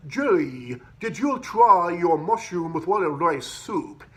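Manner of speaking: slow, mock British accent as the manager; a manager's put-on British accent, drawn out. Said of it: "that's why I'm talking funny like this".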